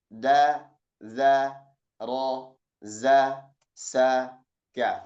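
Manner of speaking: each syllable Ka, sa, ro said crisp and separate; teacher modeling Arabic syllables; drilled syllables. Shown as a demonstration. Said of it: "Kita praktekkan".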